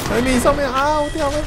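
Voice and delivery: high startled cry. Real, not acted. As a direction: yelp in surprise as you fall. 爬滑索时掉下去. (高声惊叫)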